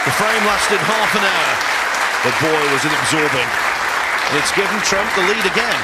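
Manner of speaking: loud, high wrap-up commentary; excited. Real, not acted. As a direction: celebrate the frame win. Frame won, commentator animated.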